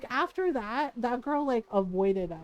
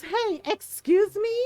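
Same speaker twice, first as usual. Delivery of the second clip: imitating an offended girl, higher and louder, slowed; mock huffy excuse me; indignant challenge; 8 semitones higher, 6 dB louder, slower than usual. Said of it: "and the girl goes".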